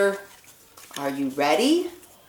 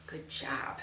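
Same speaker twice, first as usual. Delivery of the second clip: breathy whispered praise; 8 semitones lower, 18 dB quieter than usual. Real, not acted. Teacher whispering praise to a small child. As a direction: hush it out, all breath.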